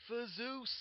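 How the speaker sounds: high mock war cry; a half-shouted retold battle cry.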